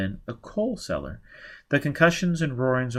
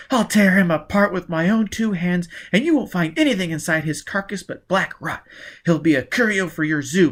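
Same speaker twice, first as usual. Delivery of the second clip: higher, louder vengeful tirade; furious threat; 7 semitones higher, 5 dB louder than usual. Acted. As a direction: promise to rip him apart. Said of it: "she was furious too".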